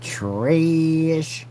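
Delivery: drawn-out mocking "trash"; dismissive verdict. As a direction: draw out each one like a dismissive judge. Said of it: "I was like".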